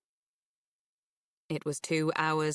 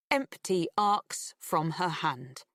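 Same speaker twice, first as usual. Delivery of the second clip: usual speaking voice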